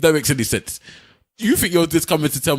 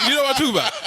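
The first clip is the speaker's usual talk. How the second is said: high, amused, laughing through it; 9 semitones higher, much faster than usual. Real, not acted. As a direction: laugh while asking. Laughs at his own comparison.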